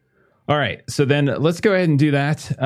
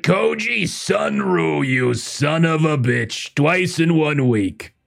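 put-on slow, drawn-out, higher greeting of an old contact; gruff editor voice; 4 semitones higher, slower than usual. Editor James greets the detective calling in, slow and drawn out. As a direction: savour each word, a sleazy editor glad to see him. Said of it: "I really like that voice".

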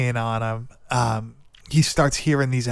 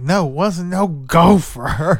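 drawn-out, higher mock protest; imitated freak-out; 4 semitones higher, 4 dB louder, slower than usual. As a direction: play a kid freaking out, hammed up. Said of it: "freaking out".

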